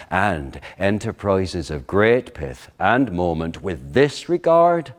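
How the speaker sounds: measured, theatrical verse speaking; Shakespeare recitation. Acted. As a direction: give the soliloquy full stage weight. Recites Hamlet soliloquy.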